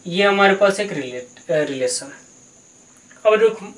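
ordinary delivery

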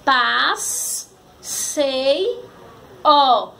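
"Paz, sei, ó" as three isolated syllables; long pause after each piece of "Paz, sei, ó"; sílabas separadas. Shown as a demonstration. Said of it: "Formou a palavra passeio". ("Paz, sei, ó" sílaba por sílaba)

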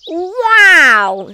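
high, loud, drawn-out wow; awestruck wow. A narrator's reading. Norman the slug admiring the snails, awestruck. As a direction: burst out in wide-eyed admiration.

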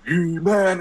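put-on high bright kids' show host voice, far above his own; cartoon host. Cartoon host welcoming kids to the show, high and cheery. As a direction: greet the kids like a peppy TV host.

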